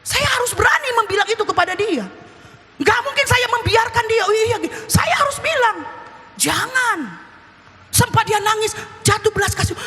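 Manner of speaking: high-pitched, insistent sermon delivery; forceful preaching. Acted. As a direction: press the duty on the congregation urgently. Preaches duty to warn son. (khotbah tegas bernada tinggi)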